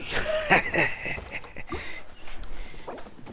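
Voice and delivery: airy high giggle fit; giggle, can't hold it in; breathless giggle. Real, not acted. Gamer giggling at an easy kill, breathless giggle.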